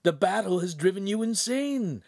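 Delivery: very high-pitched exasperation; exasperated servant. A narrator's reading. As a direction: scold the master as if he were mad. Servant exasperated with his master.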